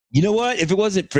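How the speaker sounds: high-pitched retold outburst; mock screaming argument. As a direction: launch an indignant comeback, not full volume. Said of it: "we're screaming at each other".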